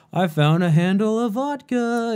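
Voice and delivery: high, eager discovery; thrilled little announcement; excited find. Retold or imitated. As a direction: show off the bottle you just found. Party bus find.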